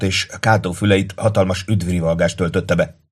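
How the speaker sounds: ordinary delivery, nothing marked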